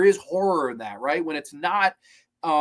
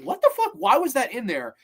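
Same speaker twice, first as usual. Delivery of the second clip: much higher, squeaky what the fuck; mock disbelief, voice jumping high; baffled outburst; 7 semitones higher than usual. Remembering first viewing, baffled squeak. Reviewer's own first reaction.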